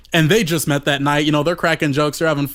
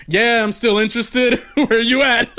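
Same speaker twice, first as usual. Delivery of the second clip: laughing; 5 semitones higher than usual. Retold or imitated. Texting back, laughing.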